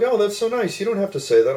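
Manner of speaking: very high, gushing imitation; imitated touched woman. Hostess thanking a polite caller, imitated touched woman. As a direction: coo it in a high voice.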